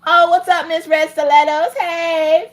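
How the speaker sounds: high, sing-out hello; playful high-pitched shout-out; gushing greeting. Real, not acted. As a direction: greet them in a squealed high voice. Greeting a viewer in put-on voice.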